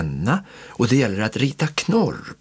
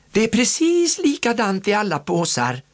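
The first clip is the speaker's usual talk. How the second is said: high, lively aunt voice; rally the children; cheerful aunt; 11 semitones higher than usual. A narrator's reading. Aunt cheering the children on, high and lively. Säger faster då.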